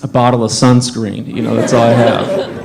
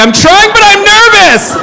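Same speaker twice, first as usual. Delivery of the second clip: very high, loud mock-panicked squeal; panicked yell; 7 dB louder than usual. New dad failing to cut the cord, hammed-up panic. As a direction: yell it in a frantic falsetto.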